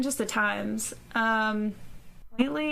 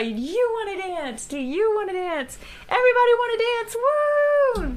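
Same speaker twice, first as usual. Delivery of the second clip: lightly voiced talk show host, high and loud; Oprah; 11 semitones higher, 4 dB louder than usual. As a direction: hype the crowd like a TV host. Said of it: "like Oprah".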